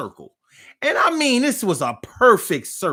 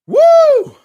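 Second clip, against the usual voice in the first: high, loud whoo after a burning drink; sharp high woo of relief; loud whoop; 16 dB louder than usual. Real, not acted. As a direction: let out a whooping yell.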